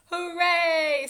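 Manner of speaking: put-on a child's delighted shout on the way to school; cheering. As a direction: burst out with a happy hooray. Child cheering on first day.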